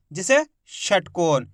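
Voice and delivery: ordinary talk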